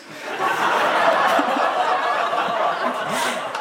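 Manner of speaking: breathless unvoiced laughter; real laugh. Real, not acted. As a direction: burst out laughing at yourself. Laughs at own remark. (ademloos lachen)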